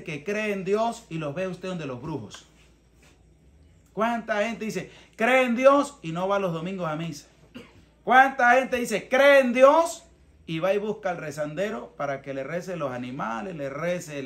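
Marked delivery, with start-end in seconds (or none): none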